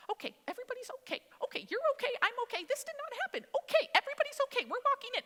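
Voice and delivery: high, hurried, forced-calm mother voice; sweet mom. Retold or imitated. Pastor's wife keeping up appearances to her boys, high sweet voice. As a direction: imitate a sweet mother papering over chaos. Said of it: "his mom is so sweet".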